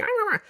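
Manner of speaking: high imitated wordless complaint; short high put-on grumble; mock grumbling cry. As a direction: make a quick mock noise of protest. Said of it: "And she's like".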